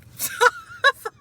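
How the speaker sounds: a high wet laugh that cracks into crying; real tearful half-laugh, half-sob; laugh turning to sob. Real, not acted. Laugh breaking into cry.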